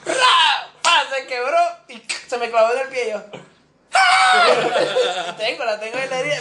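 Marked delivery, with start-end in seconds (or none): 0.1-0.9 loud, high crash noise; imitated smash. Tells how thrown bottle shattered, imitating the smash. 3.9-5.3 very high, loud shriek; re-enacted scream. Recalls glass getting stuck in the foot, screaming.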